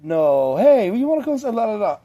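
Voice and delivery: put-on possessed girl falling back into the spell, high and sweet; sweet girl voice again. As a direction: push the cute voice high and bright. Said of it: "then she's like".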